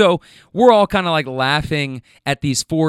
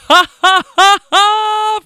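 loud, very high "ha ha" imitating a friend; imitated guffaw; 9 dB louder, slower than usual. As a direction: belt out a high mock laugh. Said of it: "Friend one starts laughing".

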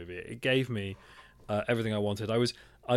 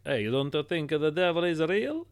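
raised, mock Italian accent; Russell Crowe's Italian accent; 6 semitones higher than usual. Exorcist challenging Vatican officials, mock Italian accent. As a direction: put on a thick Italian accent, pitched up. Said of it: "And Russell Crowe's like".

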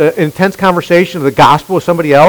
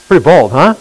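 normal talking voice

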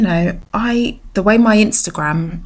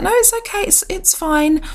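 breezy reassurance; 13 semitones higher than usual. Retold or imitated. Speaker reassuring the shocked driver.

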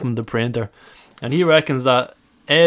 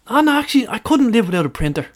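put-on high voice; 9 semitones higher than usual. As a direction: squeak it out like a friend. Friend's line retold, put on.

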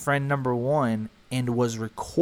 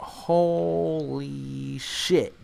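stunned; much slower than usual. Retold or imitated. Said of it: "And I'm like".

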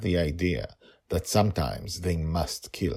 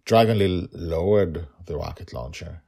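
ordinary delivery, nothing marked

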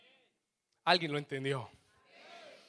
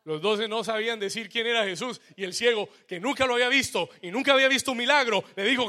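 loud, rising preacher's cry; full-force preaching; 7 semitones higher, 14 dB louder than usual. Acted. Preacher to his congregation, at full force. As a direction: preach it out to the whole church.

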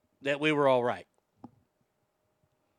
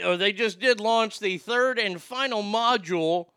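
ordinary talk, nothing marked

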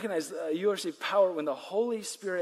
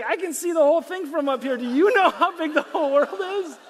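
high, loud, amused comeback; laughing reply; 9 semitones higher, 7 dB louder than usual. Retold or imitated. Jesus calling down to Peter from above, laughing. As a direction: laugh it back at him. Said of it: "And Jesus is like".

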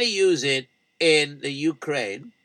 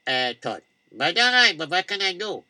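usual speaking voice

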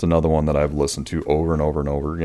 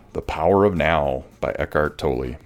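usual speaking voice

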